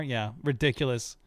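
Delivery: ordinary talk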